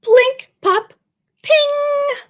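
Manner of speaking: high popping noises; spring out each sound high. Acted. Narrator voicing the robot's parts popping off, high.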